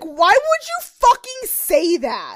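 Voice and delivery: mock outraged girls. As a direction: stretch out the indignant question. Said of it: "all the girls are like".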